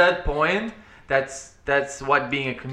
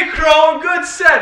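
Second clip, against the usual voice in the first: much higher, sing-song mock compliment; teasing fake praise; 13 semitones higher, 7 dB louder than usual. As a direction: needle a friend who bombed. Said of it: "And I was like".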